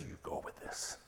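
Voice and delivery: whispered encouragement. Retold or imitated. Pastor whispering to the husband to go with it. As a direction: whisper it under your breath. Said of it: "I said".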